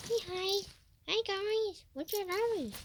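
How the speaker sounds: a sing-song high voice for the pet. Acted. Talking to pet.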